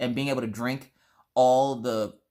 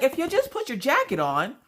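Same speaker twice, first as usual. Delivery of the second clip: high, exasperated mock nagging; worked-up pleading; 11 semitones higher than usual. Mom to son about his jacket, worked-up pleading. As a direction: plead it before calming down. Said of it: "when I finally calmed down".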